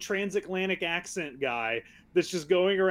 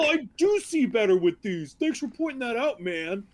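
higher put-on voice, sarcastic imitation; mock grateful realization; 5 semitones higher, 3 dB louder than usual. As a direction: imitate a clueless guy thanking his pal. Mocks goalie's reply about glasses.

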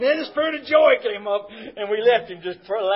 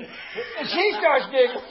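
normal talking voice

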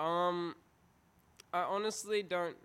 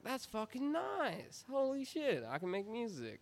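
much higher, slow, amazed voice; excited; 9 semitones higher than usual. Retold or imitated. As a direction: gasp it out in wonder. Quoting excited younger self.

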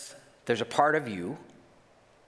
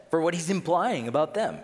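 normal talking voice

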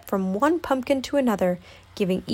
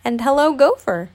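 higher, louder amused greeting; chuckling Pooh; 5 semitones higher, 5 dB louder than usual. A narrator's reading. Pooh greets the disguised Gopher, chuckling. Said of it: "he chuckled".

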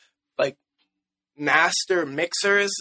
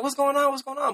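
high-pitched mock bafflement; baffled; 9 semitones higher than usual. Recalling not getting [name]'s appeal, mock baffled. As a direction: retell a puzzled reaction, pitched up. Retelling his baffled reaction.

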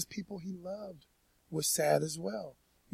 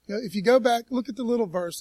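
usual speaking voice

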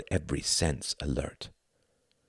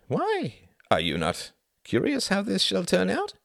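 high-pitched eager questioning; curious zealot. Acted. Klaus blinks and questions Tom, curious.

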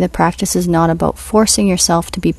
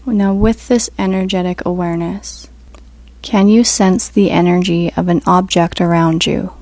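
guided meditation; 4 dB quieter than usual. Acted. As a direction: ease them into stillness, unhurried.

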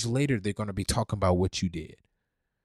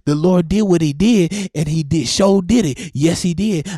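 excited preaching; 7 semitones higher, 11 dB louder than usual. Real, not acted. Call and response praise.

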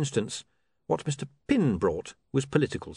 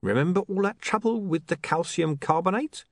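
higher, slow, pointed explanation; patient lecturing crook; 8 semitones higher than usual. Acted. Mister Pin lectures his partner on chemicals, slow, high and pointed. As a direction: spell it out for a slow pupil.

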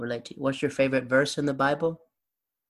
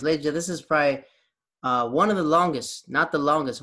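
fast, louder host announcement; announcer address; 2 semitones higher, 5 dB louder than usual. Real, not acted. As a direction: sell it to the listeners like a host. Announces to podcast audience.

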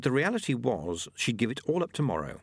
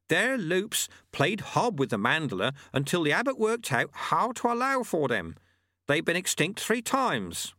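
high-pitched master's voice; explaining old monk; 9 semitones higher than usual. Acted. Old monk explaining yetis to his student, knowingly. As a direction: pitch it up as a sage old teacher. Lu-Tze's held voice.

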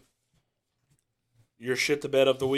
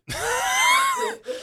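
loud laugh; 4 dB louder than usual. Real, not acted. Laughing at Robbie's question.

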